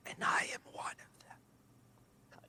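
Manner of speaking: whispered, high demonic entity. Acted. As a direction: breathe it like an ancient demon. Demon voice held.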